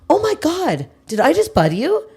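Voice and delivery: high, innocent mock surprise; surprised line cutter. Imitating the woman realizing she cut the line, high. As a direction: act caught out but not sorry. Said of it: "she goes".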